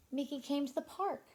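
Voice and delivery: usual speaking voice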